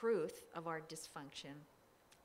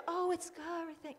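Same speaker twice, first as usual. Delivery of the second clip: mock sweet; 10 semitones higher, 5 dB louder than usual. Mock breezy nice reply.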